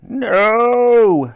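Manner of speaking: Lady Buckshot screams as she is pulled down, loud and high. A narrator's reading. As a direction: shriek in defeat. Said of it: "screamed Lady Buckshot".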